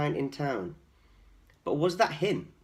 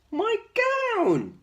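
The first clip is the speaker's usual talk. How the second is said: much higher, louder shout; joyful yell; 7 dB louder than usual. Acted. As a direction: shout with delight at finding it. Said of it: "he yelled".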